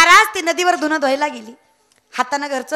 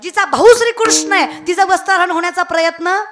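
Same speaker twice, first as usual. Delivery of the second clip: louder, higher fervent kirtan preaching; preaching at full force; 3 semitones higher, 5 dB louder than usual. Acted. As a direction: thunder the examples to the crowd. Rising preaching on Sita, Draupadi.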